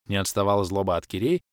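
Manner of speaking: ordinary delivery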